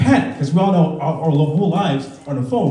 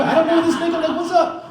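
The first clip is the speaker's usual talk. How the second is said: high, exasperated protest with a laugh; high indignant; 13 semitones higher than usual. Retold or imitated. Dad defending his daughter to the teacher, high and exasperated. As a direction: throw up your hands, voice way up.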